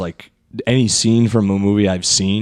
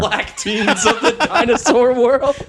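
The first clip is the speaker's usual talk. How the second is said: much higher, slow, laughing through it; 12 semitones higher, slower than usual. Pretend. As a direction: giggle the phrase again up high. Joke repeated laughing and high.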